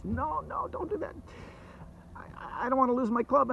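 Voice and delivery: high, alarmed no no; panicky dragon. Acted. Dragon panics at the moon threat.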